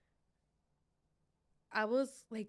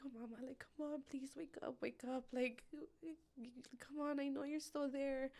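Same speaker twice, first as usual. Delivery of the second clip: tender pleading; 5 semitones higher than usual. Retold or imitated. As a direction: coax her baby awake. Mother begs unconscious baby.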